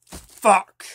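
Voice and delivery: sudden loud high swear; frustrated curse. Real, not acted. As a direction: blurt it out in frustration.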